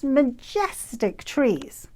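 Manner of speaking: normal talking voice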